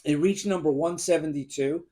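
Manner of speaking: usual speaking voice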